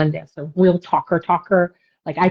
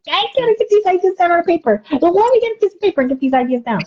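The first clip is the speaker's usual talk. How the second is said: much higher, eager and hurried; retelling her own excitement, higher and eager; excited rush; 12 semitones higher than usual. Retold or imitated. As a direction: scramble to catch the ideas. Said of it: "I got really excited".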